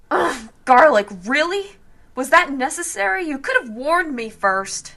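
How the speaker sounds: high, loud revolted complaint; disgusted. Acted. Bella reeling from the detective's garlic, high loud disgust. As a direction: recoil from the smell.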